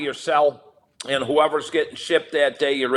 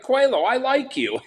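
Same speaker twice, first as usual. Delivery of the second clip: much higher, suddenly won over; gushing at the cellmate who shares his food; delighted; 10 semitones higher than usual. Retold or imitated. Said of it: "I'm like".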